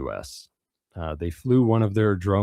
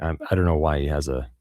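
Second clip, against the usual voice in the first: ordinary talk, nothing marked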